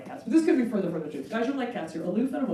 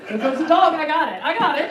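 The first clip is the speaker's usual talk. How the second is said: eager dog; 6 semitones higher, 6 dB louder than usual. Retold or imitated.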